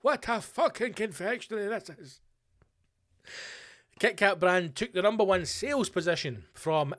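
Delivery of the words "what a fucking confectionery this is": mock awe. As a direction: marvel loudly as at a revelation. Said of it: "they're like".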